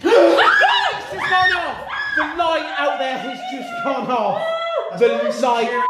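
gasping high shout, slow at first; startled shout. Real, not acted. Medium yelling to friends that the light went off. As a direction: yell in fright and laughter.

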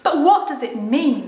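lightly acted child asking urgently; puzzled girl. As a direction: press for an explanation. Susan's question acted in story.

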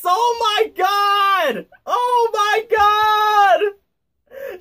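high, long-drawn wail of dismay; horrified wail. Real, not acted. Reader appalled by the story, horrified wail.

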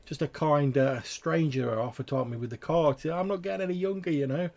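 put-on high, flustered old man brushing it off; high and hurried, an old man making excuses; flustered old cart man. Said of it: "he's like, he's like".

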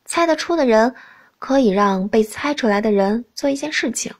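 usual speaking voice

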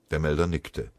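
ordinary delivery

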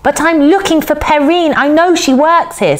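put-on high, pleading girl; urgent young protest; in a rush. A narrator's reading. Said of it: "said Nell in a rush".

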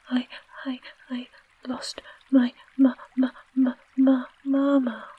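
put-on high, loud stammering toddler wail; wailing baby. A narrator's reading. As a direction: wail it in bursts like a lost small child. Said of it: "wailed the baby".

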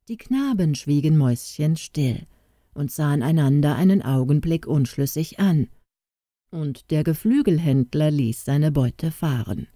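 plain reading voice

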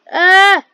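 sharp high yelp; startled scream. Real, not acted. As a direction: shriek at a sudden scare. Sudden scream in game.

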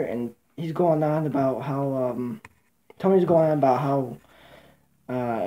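usual speaking voice

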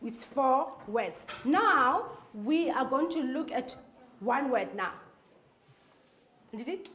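normal talking voice

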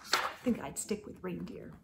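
hushed comment; soft aside. Real, not acted. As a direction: drop your voice to point something out. Quiet aside after story.

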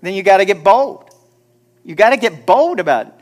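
a preacher hammering home his point; loud exhortation. Shown as a demonstration. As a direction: call it out to the whole room. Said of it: "I said".

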